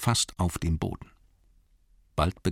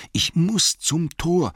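higher, slower, louder desperate repeat; Bilbo urging himself; 11 semitones higher, 3 dB louder, slower than usual. Acted. Bilbo repeating to himself, desperate and higher. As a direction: press harder, near pleading. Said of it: "sagte er sich immerzu".

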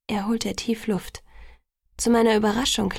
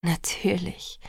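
tender reassurance; much slower than usual. A narrator's reading. His tender reassurance, whispering.

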